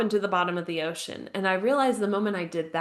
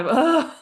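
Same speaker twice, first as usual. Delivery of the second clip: a short, high teary laugh; 8 semitones higher, 7 dB louder than usual. Real, not acted. Laughing while recalling crying.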